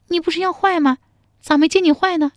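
high playful flirting; coquettish tease. Acted. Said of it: "陶三春含情脉脉地说". (含情脉脉地撒娇)

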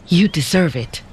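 usual speaking voice

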